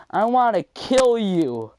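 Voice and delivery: high, loud, drawn-out mock shout; imitated yell. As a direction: mimic a classmate yelling across the room. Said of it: "and he yelled it".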